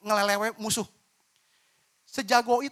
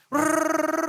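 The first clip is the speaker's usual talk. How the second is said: gossip rattle sound; 10 semitones higher, 7 dB louder than usual. Retold or imitated. Imitating fast gossip.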